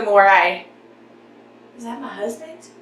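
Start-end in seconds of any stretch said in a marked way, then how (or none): 1.8-2.7 hushed, distracted aside; quiet aside. Real, not acted. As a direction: drop the voice, listening for the door. Checks if husband came home.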